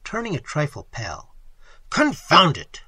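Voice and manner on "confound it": louder, higher exasperated outburst; exclaimed. A narrator's reading. Narrator reacts to the killing, exclaiming crossly. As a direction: burst out with an oath. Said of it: "I exclaimed".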